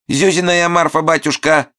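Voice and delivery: sugary high widow's voice; sweet sing-song. Acted. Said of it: "сладким голосом пропел Момус".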